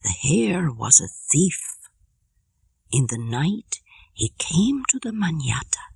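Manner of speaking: slow, hushed storytelling; slowly and softly. Acted. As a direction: ease into the fable, half whispering. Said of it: "He begins very slowly and softly".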